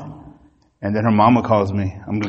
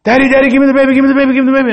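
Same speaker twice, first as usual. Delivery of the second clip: high, urgent nurses' plea; 12 semitones higher, 4 dB louder, much faster than usual. Retold or imitated. Nurses rushing the father for the baby, high urgent. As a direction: grab the baby fast, high and pressing. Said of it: "everybody's running in there".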